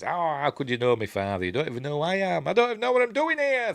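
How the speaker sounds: whiny rising outburst, climbing very high; hammed-up petulant complaint that speeds up and rises; mock tantrum. Luke losing his temper with Yoda, mock petulant whine. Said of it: "Throws things about and gets all angry".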